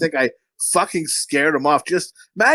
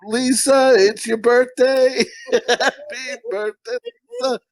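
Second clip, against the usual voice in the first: slow, much higher sing-song creep voice; creepy birthday guy; 8 semitones higher, slower than usual. Retold or imitated. Imitating a film character greeting Lisa, slow creepy lilt. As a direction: drag it out like an unsettling fan. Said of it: "the guy from".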